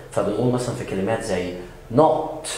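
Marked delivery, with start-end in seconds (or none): 2.0-2.5 NOT with a rounded short O; British not, never "naht". Shown as a demonstration. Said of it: "في البريتش إنجليش إحنا بننطق ال O طبيعية".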